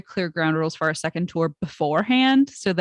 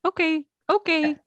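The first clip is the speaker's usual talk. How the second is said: high, meek okay okay; meek agreeing; 9 semitones higher than usual. Retold or imitated. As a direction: go along sheepishly, pitched up. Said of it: "we just kept being like".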